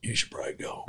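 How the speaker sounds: whispered, leaning in; hushed warning. Retold or imitated. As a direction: warn him under your breath. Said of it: "The guy goes".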